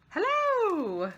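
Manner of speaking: excited. Real, not acted. Excited at card.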